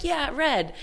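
high-pitched imitation of a kid; kid chiming in. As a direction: lightly mimic a child joining in. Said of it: "other kids can be like".

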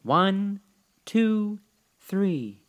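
high, sustained, separated count; solemn counting. A narrator's reading. Old woman counts with cap on her nose, solemn intoning. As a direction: intone each number deliberately, held high. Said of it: "in a solemn voice".

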